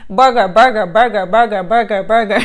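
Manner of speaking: loud, rhythmic chant of one word; exasperated comic litany; mocking repetition. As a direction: reel it off loudly, over and over, breaking into a laugh. Mocks the endless burger options.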